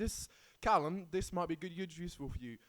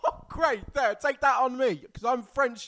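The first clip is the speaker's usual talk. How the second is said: mock outrage; 6 semitones higher, 7 dB louder than usual. Real, not acted. Mock outrage at French joke.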